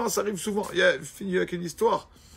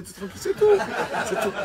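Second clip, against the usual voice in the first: laughing; 6 semitones higher, 5 dB louder, faster than usual. Retold or imitated. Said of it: "laugh heard".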